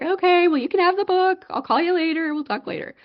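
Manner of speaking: high sing-song phone sign-off; lightly mimicked mother ending a call; imitating placating mom. Mom brushing off excited caller.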